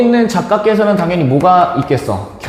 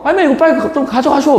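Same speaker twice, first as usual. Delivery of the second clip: high-pitched mock hurrying; rude, impatient employee; 10 semitones higher than usual. Office worker ordering the old lady to hurry, high and rude. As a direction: rush someone along in a raised pitch. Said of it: "어떻게 그렇게 폭언을 하지".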